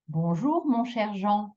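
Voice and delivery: bonjour mon cher, then a long JEAN; one lifted group, lingering on Jean; accent on final word. Shown as a demonstration. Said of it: "intonation on that last bit".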